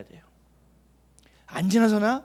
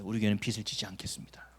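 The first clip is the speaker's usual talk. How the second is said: whisper; 8 semitones lower, 7 dB quieter than usual. Retold or imitated. Pastor recalls promise to church.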